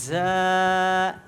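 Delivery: strong জা demo; firm letter. Shown as a demonstration. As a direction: give জা a hard, audible buzz. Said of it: "একটু শক্ত করে এটা উচ্চারণ করতে হবে".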